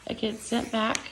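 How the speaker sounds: usual speaking voice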